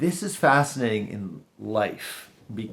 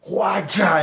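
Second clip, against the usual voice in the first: loud, high mock-karate "whacha"; sudden explosive cry imitating a hit; imitated impact noise; 8 semitones higher, 6 dB louder than usual. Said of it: "this thing goes".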